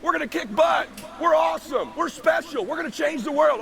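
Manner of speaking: put-on rally the kids like a proud coach; excited dad pep talk. A dad fires up his family with big dreams, excited and cheering.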